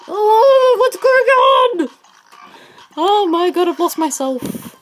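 very high, loud, drawn-out yell; panicked shriek. Real, not acted. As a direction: shriek in confusion, easing slightly by the end. Panics, lost in the game.

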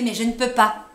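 loud, high cry of defeat; despairing cry. A narrator's reading. Trapped narrator cries out in despair. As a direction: burst out with hopeless force.

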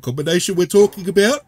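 talking through a yawn. Real, not acted. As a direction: yawn while speaking. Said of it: "Sorry, I yawned a little bit there".

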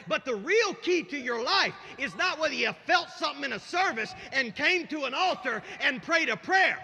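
loud, high-pitched preacher in full cry; full-force preaching. Real, not acted. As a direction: proclaim it to the whole congregation at the top of your voice.

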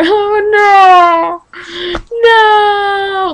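mock baby wail. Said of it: "Like a baby".

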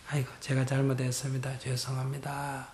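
meek, hushed "I'm sorry" to a husband; humble soft apology. Shown as a demonstration. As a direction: apologize as if bowing low to the husband. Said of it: "이렇게 하면서".

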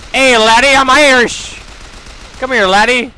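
high, loud hammed-up Irish accent; over-the-top fake Irish accent, calling out; mock Irish accent. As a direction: shout it like a cartoon Irish brawler.

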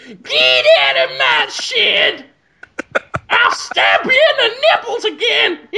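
loud, high, hammed-up shouting caricature; Shigeru Miyamoto. Comedian playing a game designer guarding his shed, mock shouting. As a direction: yell it in a put-on screechy voice. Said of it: "He'll go".

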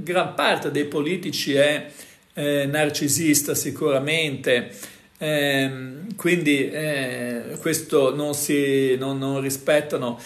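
ordinary talk

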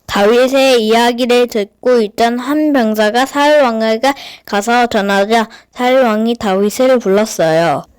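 plain reading voice

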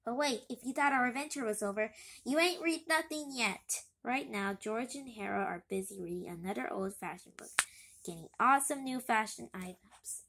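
ordinary delivery, nothing marked

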